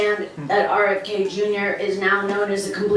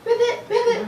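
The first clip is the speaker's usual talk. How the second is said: very high, squeaky little frog; high-pitched puppet frog; Baby Ribbit; 11 semitones higher than usual. Pretend. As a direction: croak ribbit in a tiny high voice. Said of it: "Baby Ribbit".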